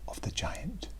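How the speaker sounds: hushed title whisper. Shown as a demonstration. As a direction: whisper the title to build suspense. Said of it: "let's find out".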